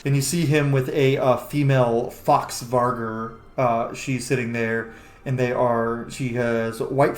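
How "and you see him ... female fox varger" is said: ordinary talk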